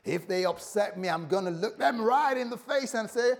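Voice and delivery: mock American accent. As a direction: act out a fired-up churchgoer in a playful American accent. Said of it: "that's my American, um, accent".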